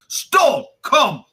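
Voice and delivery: high, loud orders; commanding shout. Acted. Boris ordering the party to follow, loud and high. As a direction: bark the commands.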